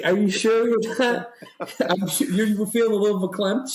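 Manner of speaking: teasing mom. Retold or imitated. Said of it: "I was like".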